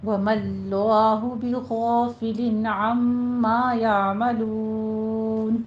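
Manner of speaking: slow, measured tajweed recitation; Quran recitation. Acted. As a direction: recite the verse slowly in melody. Recites the verse.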